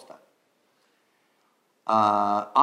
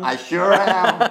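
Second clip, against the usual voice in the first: laughing, loud, higher repeat; laughing echo; 8 semitones higher, 8 dB louder, slower than usual. Real, not acted. Teacher laughing as he repeats the student's line.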